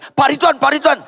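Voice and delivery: high, urgent repeated call; acted pleading shout; desperate calling out. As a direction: call after someone who is ignoring you. Parent calls to ignoring pastor.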